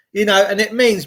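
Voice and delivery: normal talking voice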